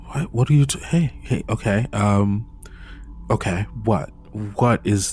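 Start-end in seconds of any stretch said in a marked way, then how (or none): none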